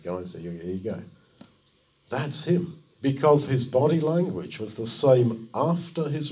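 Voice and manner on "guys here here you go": low, soft, offhand; understated, lowered-voice aside; casual offering. Acted. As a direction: hand it over quietly and casually. Jesus casually hands out bread.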